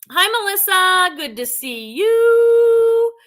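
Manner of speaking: a stretched, lilting hello to a viewer on the stream; sing-song greeting. Real, not acted. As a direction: sing the welcome out slowly and brightly.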